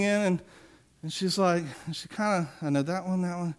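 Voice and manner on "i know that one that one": muttering. Retold or imitated. Girl muttering, retold.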